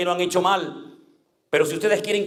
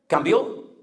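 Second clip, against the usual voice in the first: ordinary talk, nothing marked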